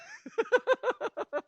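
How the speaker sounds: high-pitched chuckle; short burst of high laughter. Real, not acted. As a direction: laugh high at your own joke. Laughs after his own joke.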